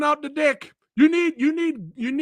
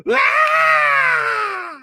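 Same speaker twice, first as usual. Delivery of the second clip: high, loud, drawn-out scream of terror, imitating the guard; imitated scream; 7 dB louder than usual. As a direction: scream like the guard seeing something huge. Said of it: "and he screams".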